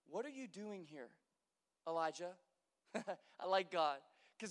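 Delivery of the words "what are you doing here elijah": soft, low question; quiet reading. A narrator's reading. The Lord asking Elijah quietly in the cave. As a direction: read it hushed and calm. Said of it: "And he said to him".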